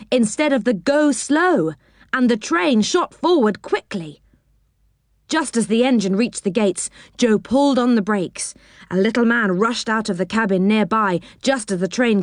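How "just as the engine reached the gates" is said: ordinary delivery, nothing marked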